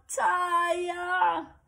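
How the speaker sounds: high loud shout for dad; yelling. Acted. Girl trapped in a box calling her dad, long high shout. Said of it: "She even yelled".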